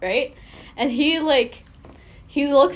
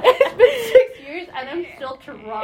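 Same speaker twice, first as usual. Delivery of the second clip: laughing, higher and louder; 7 semitones higher, 6 dB louder than usual. Real, not acted. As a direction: laugh through the admission. Laughing at own trauma.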